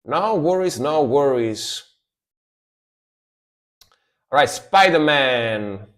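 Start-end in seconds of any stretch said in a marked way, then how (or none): 0.0-1.8 high, easy-going no worries, falling off; breezy high brush-off, settling down; casual reassurance. Pretend. Telling the boss on payday, breezy high reassurance. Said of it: "I will say".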